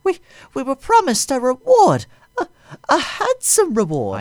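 high, loud pleading woman's voice; tearful high plea. A narrator's reading. CEO begging the Sith Lord for a reward, high tearful voice. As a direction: beg high and loud through tears. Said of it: "with tears streaming down her cheeks".

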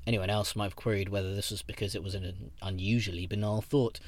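plain reading voice

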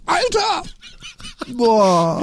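überrascht. Real, not acted. Reaction after biting sour plum.

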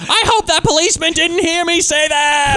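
high-pitched hammed-up hysterical yell; mock screaming. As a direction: scream it out like the panicking character. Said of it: "fucked it up by screaming so much".

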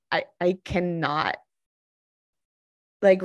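normal talking voice

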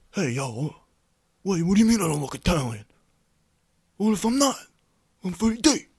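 high, loud tough-guy voice; Freddie D persona. Acted. His angry alter ego defending his Italian looks, loud and high. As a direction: bluster like an offended wannabe Italian. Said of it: "Freddie D is gonna be my new persona when I'm angry".

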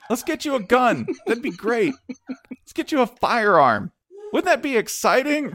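high-pitched, frantic bargaining; hammed-up parental desperation, voice climbing; desperate dad pleading. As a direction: distract the kid with mock-cheerful panic. Desperate dad distracting his child.

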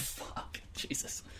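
whispered swearing under the breath; hushed, voiceless retold reaction; whispered dismay. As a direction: let the curse escape as a low whisper. Retelling his reaction in hospital.

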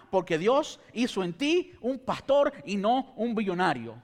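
loud, fervent sermon delivery; preaching. Acted. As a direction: preach it with rising fire. Preaches at full force.

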